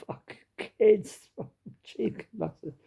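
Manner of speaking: very soft, half-voiced grumble; muttered aside. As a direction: grumble under your breath to a fellow customer. Mock aside to the waiting woman.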